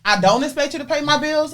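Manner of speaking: ordinary delivery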